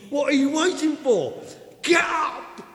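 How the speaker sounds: loud, high, slow shout; excited shout. Acted. Preacher voicing Ananias to Paul, excited shout. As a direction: bellow the question as Ananias. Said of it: "Sorry, me shouting. I'm excited".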